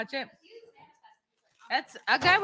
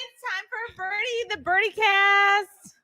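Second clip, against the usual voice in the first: announcer voice; 5 semitones higher, 7 dB louder, slower than usual. Acted. Podcast intro announcement.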